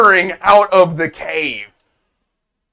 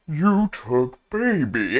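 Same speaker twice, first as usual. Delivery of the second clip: slow, simple-minded creature voice; slowly accuse, as a lumbering creature; accusing Bob; 5 dB quieter, slower than usual. Acted. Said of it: "Bob turns and looks at this kobold and says".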